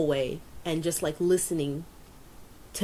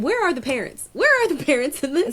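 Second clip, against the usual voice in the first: high, louder, rising indignation; exasperated; 8 semitones higher, 5 dB louder, faster than usual. Real, not acted. Podcaster ranting about the neglectful parents, exasperated. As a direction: demand an answer, fed up.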